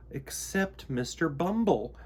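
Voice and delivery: plain reading voice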